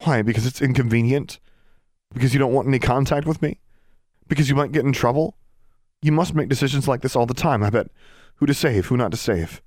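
heated, pressing argument; accusing. A narrator's reading. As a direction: fire the questions at them. Accusing sister in quarrel.

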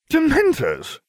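a sudden high, loud rant; shrill outraged outburst. A narrator's reading. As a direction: spit the word out in outrage. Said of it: "I ranted".

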